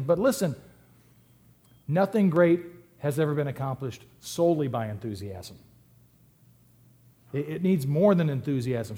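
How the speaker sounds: ordinary talk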